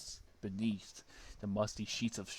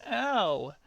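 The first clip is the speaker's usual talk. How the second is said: higher, louder yelp; Sonic voice; 11 semitones higher, 8 dB louder than usual. Acted. Sonic face down in the crater. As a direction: whimper out a pained ow. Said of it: "said Sonic".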